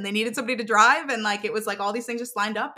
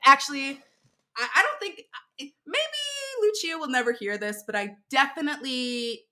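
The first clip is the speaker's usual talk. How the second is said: usual speaking voice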